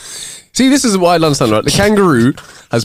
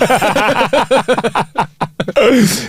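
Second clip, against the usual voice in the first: a long laugh pitched up; long high laugh; 8 semitones higher than usual. Real, not acted. Cohost laughing at his own [name] joke, high.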